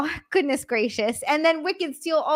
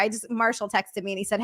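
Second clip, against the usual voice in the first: usual speaking voice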